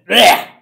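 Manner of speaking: loud fake retch; a loud disgusted blech; loud gagging noise. Real, not acted. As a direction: gag at them in disgust. Mimes throwing up.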